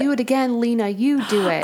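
a hint of a little kid; imitating a child's voice. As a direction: echo the ghostly child's words with a lifted lilt. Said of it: "A child's voice can be heard saying".

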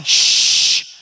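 sharp unvoiced shush. Friend on the roof shushing another friend, sharp hush. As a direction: hush him quickly. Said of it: "Now he's shushing him".

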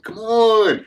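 high, drawn-out mock protest; Corny Cobb protesting. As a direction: whine it upward as an imitated objection. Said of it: "Corny Cobb is over there".

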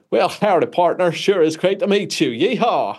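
high, hammed-up Texan cowboy twang; thickest Texan accent. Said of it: "with the thickest Texan".

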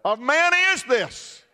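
high, awed wonder; frightened disciples asking in awe; amazed disciples. Acted. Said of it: "And the disciples said".

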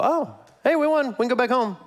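casual victor. Retold or imitated. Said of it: "our enemy doesn't just go".

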